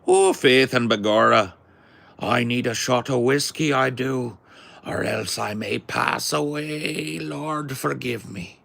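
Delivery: high, lilting stage-Irish. Shown as a demonstration. Asked for their best Irish, high hammed-up Irish accent. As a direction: ham up an Irish accent, drawing out the end. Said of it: "Do your best Irish accent".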